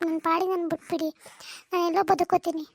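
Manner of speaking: ordinary talk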